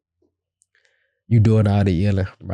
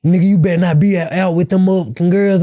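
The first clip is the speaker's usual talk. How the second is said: angry warning; 7 semitones higher, 3 dB louder than usual. Retold or imitated. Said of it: "You probably wanna say".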